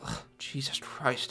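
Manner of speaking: soft, slow exasperated aside; muttered to himself. Retold or imitated. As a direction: sigh it under your breath. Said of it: "says to himself".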